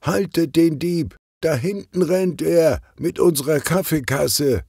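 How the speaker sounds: high, urgent call-out; Ausruf. A narrator's reading. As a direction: call after a fleeing thief, pitched high. (hohes, aufgeregtes Rufen)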